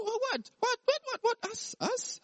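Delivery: high squeaky repeated disbelief; mock shocked. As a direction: sputter in fake shock. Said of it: "they said".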